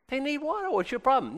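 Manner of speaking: high-pitched, unbothered questioning; God. Acted. Preacher voices God questioning testy Moses, high mild bemusement. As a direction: lift it high without raising volume, mock puzzlement. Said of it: "and God's like".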